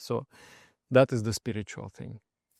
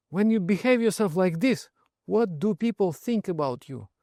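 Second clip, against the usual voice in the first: higher, lecturing reproach; scolding friend; 7 semitones higher than usual. Retold or imitated. Friend scolding drunk monk.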